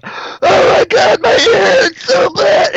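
very high, loud, slow crying shout; hammed-up wife in agony over her ear; mock pained wail. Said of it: "she was like".

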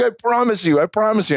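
high-pitched, urgent pleading to be believed; earnest insistence. Retold or imitated. Husband convincing doubtful partner about the birth, earnest insistence. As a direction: re-enact swearing it is true, pitched high.

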